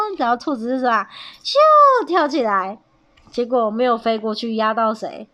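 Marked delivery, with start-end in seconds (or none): 1.4-2.0 high, loud, drawn-out whoosh; imitated whoosh. A narrator's reading. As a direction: voice a big jump through the air. 模仿跳起来的声音. (拉长声音模仿咻的一声)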